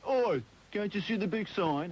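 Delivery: high, drawn-out Cockney bar worker; Cockney accent. Retold or imitated. Snubbed customer retells the bar worker's reply, in Cockney. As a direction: put on a slow, high-pitched Cockney. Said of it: "That's a great Cockney accent".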